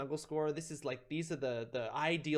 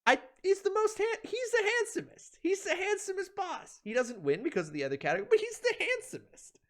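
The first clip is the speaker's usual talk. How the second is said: much higher, dreamy swoon over him; swooning gush. Acted. Vlogger swooning over the handsome Hive Knight, high and dreamy. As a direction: gush like a smitten fan. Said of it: "And if I might say so".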